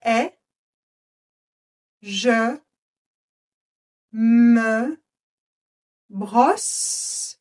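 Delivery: slow, one word at a time with pauses; word-by-word dictation. Shown as a demonstration. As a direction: dictate each word separately. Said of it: "je vais parler lentement". (dictée mot par mot)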